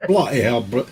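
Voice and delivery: mild Liverpool "Bloody hell", a touch of Ringo; lightly put-on Liverpool accent on "Bloody hell"; first go at a Liverpool "Bloody hell", held back; [name] voice. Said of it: "If that wasn't Ringo".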